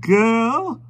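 put-on high old man asking; brief elderly query; high, curious old gentleman; old stranger's voice.

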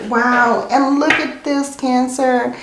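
thrilled by the card she drew; excited. Real, not acted. Excited reveal of a tarot card.

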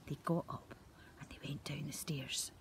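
soft, sneaky night whisper; hushed whisper. A narrator's reading. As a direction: whisper like creeping downstairs at night.

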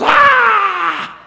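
loud, high, drawn-out "Aah!"; sudden high scream at full voice; ghost's wail. Acted. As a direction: let out a ghostly shriek. Marley's ghost wails at Scrooge.

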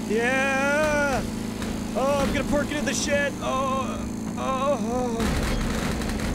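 put-on drunk neighborhood dad. Imitating the drunk dad down the street, boozy dad voice. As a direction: slur it slowly like a happy drunk on his mower. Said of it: "like, a lawnmower drunk".

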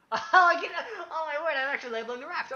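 high, loud, laughing amazement; giggly thrilled squeal; laughing delight. Real, not acted. Delighted game discovery.

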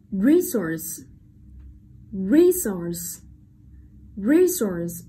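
slow, careful resource, weight on the opening RE; stress on first syllable. Shown as a demonstration. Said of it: "trọng âm sẽ rơi vào âm tiết".

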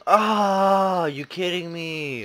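high, slow loud exasperation; outraged at the game; shocked disbelief. Real, not acted. Dies in game.

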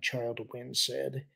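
usual speaking voice

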